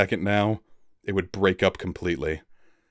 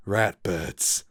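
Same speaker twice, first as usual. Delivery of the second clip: horrified murmur. Acted. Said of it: "Tem murmured with horror".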